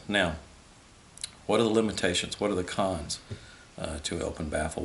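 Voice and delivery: ordinary delivery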